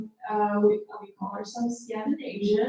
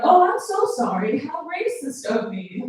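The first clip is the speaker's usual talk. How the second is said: high, gushing fake apology; mock apologetic; 6 semitones higher, 4 dB louder than usual. Skinhead imagined apologizing to the uncle, high mock contrition. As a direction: apologize profusely, raised pitch, slowing at the end. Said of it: "As if the skinhead would've turned around".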